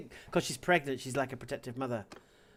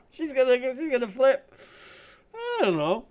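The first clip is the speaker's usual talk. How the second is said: loud, high, just out of a laugh; giddy and raised; giddy amusement; 10 semitones higher, 8 dB louder than usual. Real, not acted. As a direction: blurt it out high, still amused. Laughing, anticipating an angry answer.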